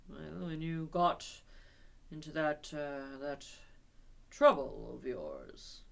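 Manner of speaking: slow and impressive. Acted. Rat breaking bad news to Toad slowly and gravely. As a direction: drag it out for effect. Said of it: "said the rat slowly and impressively".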